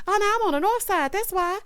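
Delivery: high, fast chatter; imitated interrupting bride. Bride interrupting the groom's vows, mock chatter.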